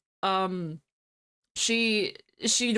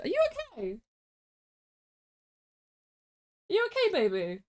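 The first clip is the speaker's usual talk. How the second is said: worried parent; 9 semitones higher, faster than usual. Real, not acted. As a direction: coo anxiously to a frightened puppy. Comforting scared dog.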